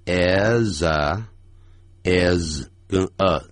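is a run together as iza, very slow; linked is a. Shown as a demonstration. Teacher slowly linking is and a. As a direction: glide is straight into a. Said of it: "合在一起产生连音".

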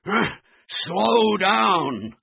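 high, drawn-out puffed plea; gasp, then drag out a high plea; panting. Acted. Husky dogs struggling behind Floppy, panting. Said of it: "panted the husky dogs".

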